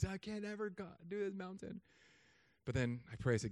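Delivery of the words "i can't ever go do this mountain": high, soft strained voice; mock strained struggler. Debtor facing a mountain of student loans, mock strained voice. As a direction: act swamped and unable to climb. Acting out being buried in debt.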